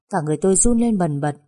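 ordinary delivery, nothing marked

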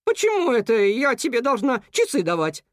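indignant cuckoo. A narrator's reading. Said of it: "рявкнула она".

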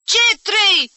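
loud, high angry retort; explosive indignant shout; thundering. A narrator's reading. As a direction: boom it from the doorway. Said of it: "tună de asta dată vocea".

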